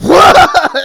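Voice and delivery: loud high laughing yelp of shock; startled yelp. Retold or imitated. Caught off guard by the second round, a loud laughing yelp. Sudden eruption mid-story.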